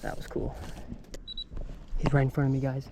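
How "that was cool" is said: whispered. Real, not acted. Quiet awe after the catch.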